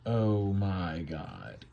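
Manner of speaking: slow, low, hushed dread; stunned angels. Acted. Angels see a flurry of arrows, stunned. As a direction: draw it out quietly, stunned. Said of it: "they go".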